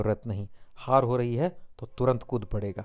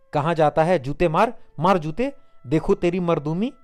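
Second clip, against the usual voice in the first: furious dare; 5 semitones higher, 6 dB louder than usual. A narrator's reading. Dhaniya shoves, dares him.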